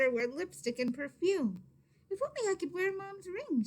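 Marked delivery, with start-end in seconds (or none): 2.1-3.6 higher, dreamy wishing voice; wishful thought. A narrator's reading. Little girl longing for mother's ring, wistful higher voice. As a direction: let Maria sigh for the ring, lifted high. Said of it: "she thought to herself".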